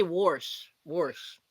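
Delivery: "warsh" with an r before the sh; Pittsburgh accent "warsh". Shown as a demonstration. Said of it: "they'll say".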